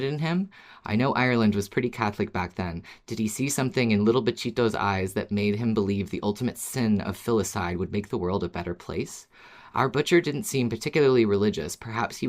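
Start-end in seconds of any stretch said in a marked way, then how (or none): none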